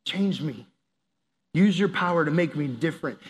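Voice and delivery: prayer. Acted. Prays honestly to God.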